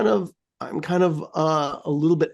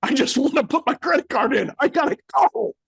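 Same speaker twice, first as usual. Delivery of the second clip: frustrated protest; 8 semitones higher than usual. Retold or imitated. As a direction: protest at the machine, rising in frustration. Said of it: "It's like".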